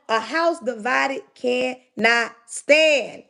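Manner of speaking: "cannot stand" slowly, each word set apart; emphatic pronouncement. Real, not acted. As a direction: drag out "cannot stand" with pauses between words. Drives home a repeated saying.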